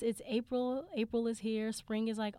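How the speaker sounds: usual speaking voice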